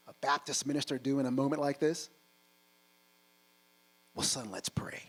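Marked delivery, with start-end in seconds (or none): 4.1-5.0 hushed, solemn father whispering a prayer invite; whispered preacher dad. Retold or imitated. Baptist minister dad answers.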